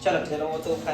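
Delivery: ordinary delivery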